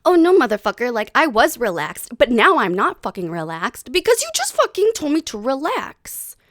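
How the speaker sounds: high-pitched indignant rant; blood boiling. Acted. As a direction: snap back, fed up at being told to relax. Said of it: "my blood would then start boiling".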